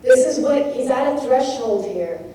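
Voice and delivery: ordinary talk, nothing marked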